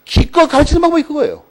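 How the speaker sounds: indignant teacher scoff; exasperated outburst. Real, not acted. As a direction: vent your disbelief at the advice. Exasperated at friend's useless advice.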